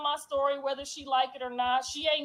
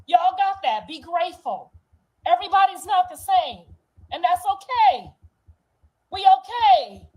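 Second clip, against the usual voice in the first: higher, louder voice straining with feeling; emotional; 4 semitones higher, 6 dB louder than usual. Real, not acted. Telling viewers she is okay, voice rising with emotion. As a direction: hold it together while it rises.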